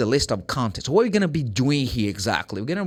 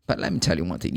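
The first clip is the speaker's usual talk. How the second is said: normal talking voice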